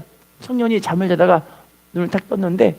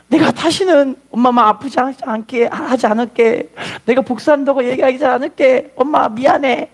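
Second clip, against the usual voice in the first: imitated weepy apology, higher pitch; tearful apology; 5 semitones higher than usual. Son apologising in mother's arms, acted crying. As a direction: act a son blubbering a promise. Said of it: "엄마랑 붙잡고 막 울는".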